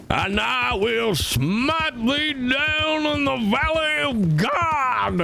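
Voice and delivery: high, slow booming mock prophet; crazy preacher. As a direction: thunder out a fire-and-brimstone curse. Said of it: "Crazy one-time preacher".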